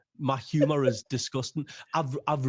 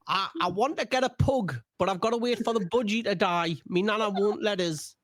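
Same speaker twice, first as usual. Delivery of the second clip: higher silly put-on voice, imitated; stupid voice; 6 semitones higher than usual. Comedian imitating his mate's dad on the phone, silly high voice. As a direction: mimic a daft YouTuber voice for the dad. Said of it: "having this back and forth".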